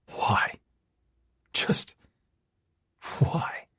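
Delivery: breathy whisper, despairing. Acted. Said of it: "I whisper".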